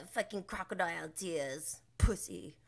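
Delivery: hushed whispered jab; half-whispered mocking taunt; sneering aside. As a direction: spit the insult under the breath. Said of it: "It's like".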